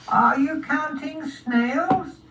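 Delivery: high, slow snail voice; drawn-out high snail question; inquiring snail. Acted. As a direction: ask it slowly as a tiny snail.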